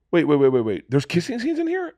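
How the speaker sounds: halting string of words into a high, incredulous question; acting out dawning disbelief; retold disbelief. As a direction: stop them, then squeak the question in mock shock. Retold shock at learning of scenes.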